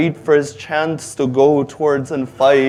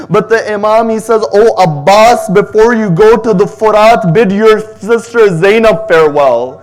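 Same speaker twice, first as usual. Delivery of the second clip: higher and louder, a grieving majlis chant; keening sermon at full force; rising lament recitation; 5 semitones higher, 6 dB louder than usual. Acted. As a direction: pour out the tragedy of Abbas to the mourners. Builds into a mourning recitation.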